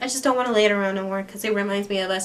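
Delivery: fast, rushed mock excuse; a hint of a girl rushing through a feigned excuse. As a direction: blurt the excuse quickly, lightly voiced. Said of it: "She's just like".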